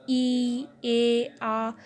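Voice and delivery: E, A, A as separate, steadily held vowels; frictionless vowel sounds. Shown as a demonstration. Teacher showing vowels made without friction, E, A, A held open and smooth. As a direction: let E, A, A flow out with no friction. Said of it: "Without friction means flawless".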